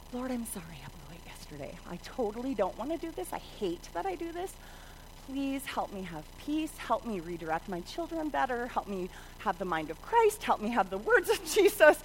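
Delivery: soft, low, pleading prayer; acted-out quiet plea for help; repentant prayer. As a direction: confess humbly to God. Acts out a repentant morning prayer.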